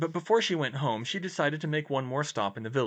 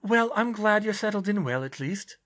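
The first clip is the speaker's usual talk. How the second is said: higher-pitched character voice, earnest; raised, sincere dialogue voice; earnest young heroine; 11 semitones higher, 4 dB louder than usual. Acted. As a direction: voice the concerned friend, pitched up.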